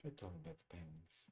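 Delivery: muttering. Real, not acted. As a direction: mutter it to yourself. Repeated, second muttered.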